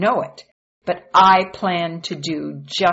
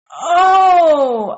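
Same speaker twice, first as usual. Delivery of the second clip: a loud, much higher anguished cry of Oh; loud groan; 12 semitones higher, 11 dB louder than usual. A narrator's reading. Moses seeing his hand turn leprous, crying out loud. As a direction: wail it out in shock. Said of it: "I groaned".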